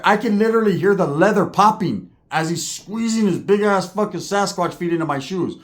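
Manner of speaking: ordinary talk, nothing marked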